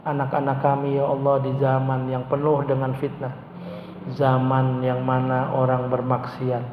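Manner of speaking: earnest congregational supplication; leading a prayer. Acted. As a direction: plead humbly on behalf of the congregation. Leads the closing prayer.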